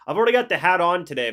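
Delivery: loud, fast, fired up; excited. Real, not acted. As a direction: blurt it out proudly. Excited about wearing his hat.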